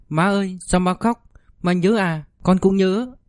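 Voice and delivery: put-on worried little boy comforting mom; small voice asking why mom cries. Child asks why mother cries.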